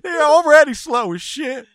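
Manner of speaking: high, loud tearful wail; loud weepy complaint. Real, not acted. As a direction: wail it through tears. Crying rant about the turtle.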